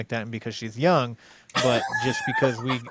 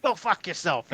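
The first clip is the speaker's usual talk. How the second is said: higher, mock-furious parting insult; retold angry retort; 6 semitones higher than usual. Girlfriend before hanging up, imitated angry retort.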